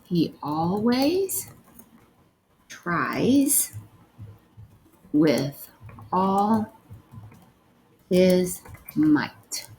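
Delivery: one word at a time, with long gaps; word-by-word dictation. Shown as a demonstration. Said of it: "the next sentence you're gonna write is".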